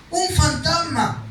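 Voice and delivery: disciples' alarmed cry, read out; frightened cry. A narrator's reading. Disciples crying out in fear, read aloud.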